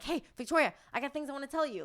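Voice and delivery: put-on high, loud, impatient kid blurting out news; eager little kid. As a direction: bounce in like a kid who can't wait. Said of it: "I'm like a little kid".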